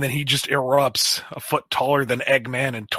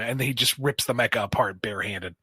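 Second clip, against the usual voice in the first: ordinary delivery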